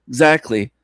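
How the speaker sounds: ordinary talk, nothing marked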